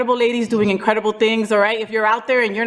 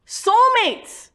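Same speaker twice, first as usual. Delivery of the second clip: excited punchline; 11 semitones higher than usual. Acted. Punchline of segment topic.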